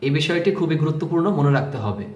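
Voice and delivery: normal talking voice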